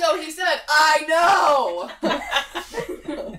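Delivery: mock eager translator. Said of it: "[name] from now on is going to just start translating".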